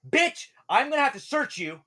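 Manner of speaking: high, loud hostile outburst; Jekyll-and-Hyde aggression. Acted. Drow guard threatening to search Wendelin, aggressive shout. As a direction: snap loudly into aggression. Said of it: "Jekyll and Hyde. He's like".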